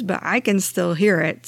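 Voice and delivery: ordinary talk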